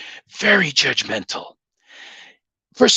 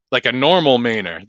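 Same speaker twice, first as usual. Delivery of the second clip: ordinary talk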